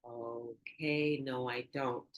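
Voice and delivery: low, hushed admission; very soft, drawn-out, hesitant concession; pausing, sobered. Retold or imitated. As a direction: back down softly after a pause. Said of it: "that's when I took pause".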